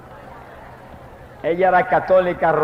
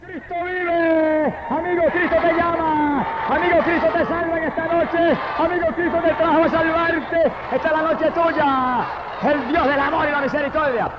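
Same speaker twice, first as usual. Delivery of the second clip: very high, stretched preaching cry; high-pitched evangelistic plea; impassioned altar call; 10 semitones higher than usual. Real, not acted. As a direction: call the sinner with long, ringing phrases.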